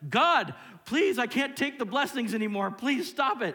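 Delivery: high, loud, overwhelmed begging; yelled plea. Acted. Believer begging God to halt the blessings, yelled plea. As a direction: yell up to God as if overloaded. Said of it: "And I yelled at you".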